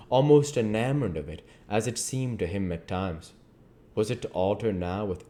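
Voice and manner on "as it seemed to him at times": plain reading voice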